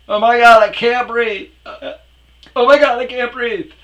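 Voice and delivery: very high, very loud shrieking; hysterical mock panic. Imitating girlfriend unable to breathe at the bar, shrieking hysterically. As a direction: shriek it like a hammed-up panic attack. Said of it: "panic attacks".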